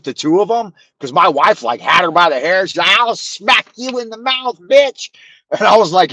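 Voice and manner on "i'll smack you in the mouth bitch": high-pitched imitation of an old woman threatening; mock furious threat. Mother-in-law threatening her daughter, high mock snarl.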